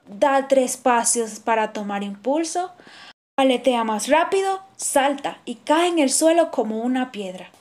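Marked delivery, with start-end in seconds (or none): none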